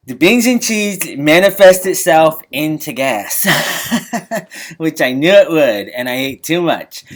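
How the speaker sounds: ordinary talk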